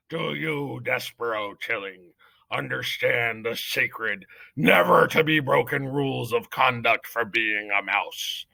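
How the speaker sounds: slow, weighty judge's voice; pompous mouse judge addressing the accused; solemn head mouse judge. Acted. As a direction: pronounce each word like law. Head mouse reads the charges.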